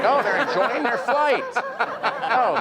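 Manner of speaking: high-pitched mock excitement; excited realisation. Baffled viewer finally following the film, excited realisation. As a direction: pitch it way up, hammed-up.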